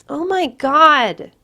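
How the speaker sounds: cries out. A narrator's reading. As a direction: wail it out in shock. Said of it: "she cries out".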